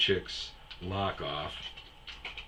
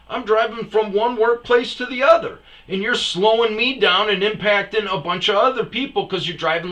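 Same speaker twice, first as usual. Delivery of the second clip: much higher, louder shouting tirade; furious rant; 11 semitones higher, 11 dB louder than usual. Retold or imitated. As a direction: lay into him, building to the end. Ranting at the Uber driver.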